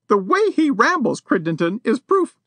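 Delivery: high-pitched outraged society lady; a lady defying a burglar, high and indignant. A narrator's reading. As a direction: threaten him from injured dignity. Missus Champney voiced.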